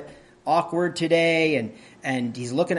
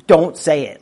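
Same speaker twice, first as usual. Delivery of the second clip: firm command; 8 dB louder than usual. Real, not acted. Urges restraint firmly.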